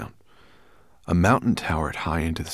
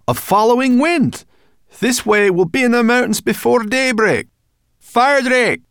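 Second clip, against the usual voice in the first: high, full-voiced shout; calling out; 10 dB louder than usual. A narrator's reading. Sorrel hailing Firedrake in flight, calling out. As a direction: holler it up to the dragon.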